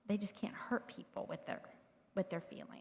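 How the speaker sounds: hushed, confiding whisper. Real, not acted. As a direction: lean in and whisper the key point. Stresses key point to parents.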